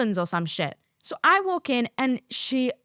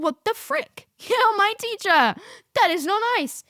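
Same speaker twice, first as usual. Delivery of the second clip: put-on much higher, hurt teenage whimper; mock teary whine; 11 semitones higher, 3 dB louder than usual. Sixteen-year-old hurt by teacher, high teary whine. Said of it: "this made me cry".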